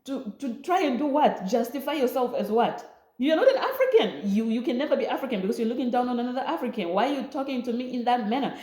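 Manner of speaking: defending herself to the woman, heated; indignant retort. Retold or imitated. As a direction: tell off the grown woman. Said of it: "I was like".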